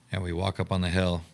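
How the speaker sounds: normal talking voice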